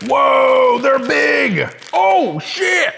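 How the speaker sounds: sudden high, loud shout of surprise; startled shout. Real, not acted. Opening a snack and shocked by its size, shouting. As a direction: yelp it out, caught off guard by the size. Bear claws bigger than expected.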